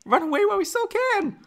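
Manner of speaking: very high, put-on feminine falsetto; high-pitched mock heartbreak; Padme. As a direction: plead melodramatically in falsetto. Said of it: "my Padme impression?".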